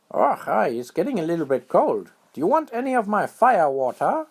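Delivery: very high, excited little squirrel voice; high squeaky critter voice. A narrator's reading. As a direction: chirp it out like a tiny squirrel. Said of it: "said Squirrel".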